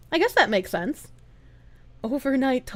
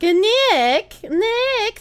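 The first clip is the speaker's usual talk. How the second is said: excited call; 8 semitones higher than usual. Real, not acted. Thanking chatter Nick.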